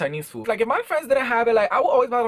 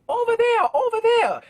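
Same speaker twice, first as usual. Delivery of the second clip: much higher, louder shrill command; mock Chinese lady shouting; 3 dB louder than usual. Restaurant lady shooing teens off her storefront, high mock yell. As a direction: shoo them away in a mock accent. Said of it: "and she's like".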